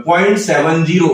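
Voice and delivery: Point seven zero, digits read one by one as seven, zero; point seven zero. Shown as a demonstration. Teacher shows students the right way to read decimals, digit by digit. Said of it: "point seventy नहीं हो".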